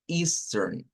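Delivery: Eastern said the American way, ending in a clear R; American Easter. Shown as a demonstration. Teacher models the American pronunciation for Spanish-speaking students. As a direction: model Eastern slowly with the R sound at the end. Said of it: "vamos a decir Eastern".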